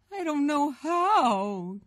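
high, slow, wailing cry; crying child. A narrator's reading. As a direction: drag it out like a helpless boy. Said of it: "Gabe cried".

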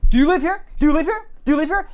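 imitating her high, repeated demand; mock interrogation, pitched high; badgering. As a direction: fire the question at them insistently. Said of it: "she kept cutting me off".

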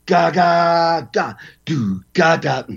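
guitar chug sung as ga syllables; vocalized guitar riff. Retold or imitated. Drummer showing the host the guitars, vocal riff sounds.

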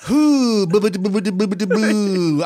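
goofy silly voice. Pretend. Joking with co-host, high silly sing-song. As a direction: bounce the boos up high. Goofy mock playing along.

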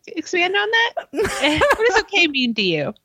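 high, laughing mock of Jen. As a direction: squeak out the prodding through laughter. S0 keeps voicing Jen.

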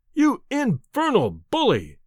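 high, slow indignant cry. A narrator's reading. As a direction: hurl the insult angrily. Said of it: "I cried".

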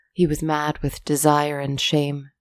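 ordinary delivery

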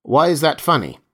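sharp question; sharply. A narrator's reading. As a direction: cut in sharp, demanding an answer. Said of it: "Meyerhoff said sharply".

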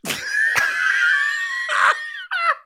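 long loud shrieking laugh; real cracked-up howling laugh; helpless laughter. Real, not acted. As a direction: laugh until you can't breathe.

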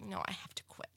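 breathy unvoiced whisper of a private vow; whispered reply. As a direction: whisper it to yourself, resigned. Retold reply to doctor, whispered.

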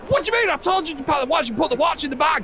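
frantic. Acted. Frantic argument about the watch.

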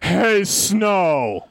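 drawn-out mock character voice; Daenerys Targaryen. Host in speed round of impressions, drawn-out mock voice. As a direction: stretch both words like the same catchphrase. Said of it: "Darnarius Targaryen?".

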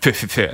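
quick puffs mimicking spitting; imitated spitting. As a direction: spit it out in little bursts. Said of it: "started spitting out the blood".

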